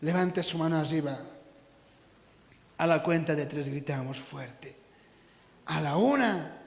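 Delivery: very low, soft instruction; quiet, low suspense with long pauses; hushed countdown. Real, not acted. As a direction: hold it right back before the big shout.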